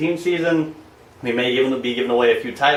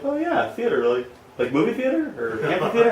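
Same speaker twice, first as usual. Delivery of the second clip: confused person voice; 10 semitones higher than usual. Retold or imitated. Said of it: "some people are like".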